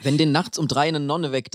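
usual speaking voice

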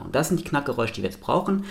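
usual speaking voice

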